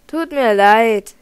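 loud, slow call down from above. Acted. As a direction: call the apology down slowly and loudly. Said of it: "rief Debug". (lautes, langsames Rufen)

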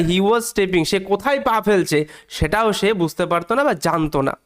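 usual speaking voice

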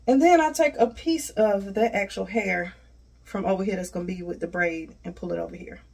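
ordinary talk, nothing marked

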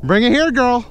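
high, loud call to the dog. Real, not acted.

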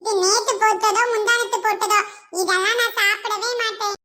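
a hint of a spoilt kid; fussy child. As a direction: play a picky little boy turning up his nose. Child voiced by adult.

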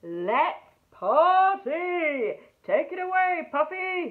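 loud, slow jubilant call; party cry. Acted. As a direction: cheer them into the party. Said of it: "he cried".

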